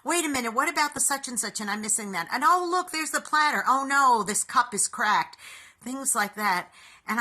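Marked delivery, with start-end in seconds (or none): 0.0-5.4 higher, quick, flustered mutterings; frazzled. Retold or imitated. Woman unpacking tangled decorations, mock frazzled. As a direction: replay your own holiday panic, rushing. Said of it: "I was finding myself very frustrated".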